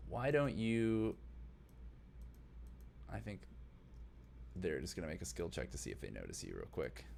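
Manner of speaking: usual speaking voice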